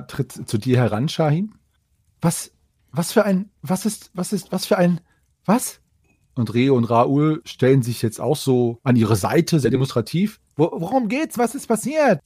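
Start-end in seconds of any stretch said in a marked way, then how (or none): 2.2-5.8 put-on flustered girl voice. 10.6-12.2 worried high voice. Acted.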